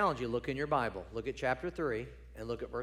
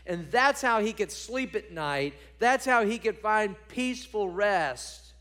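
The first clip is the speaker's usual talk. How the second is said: loud, higher preaching declamation; impassioned psalm reading; 7 semitones higher, 6 dB louder than usual. Acted. Preacher reading David's morning prayer, impassioned and loud. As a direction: proclaim the prayer with ringing force.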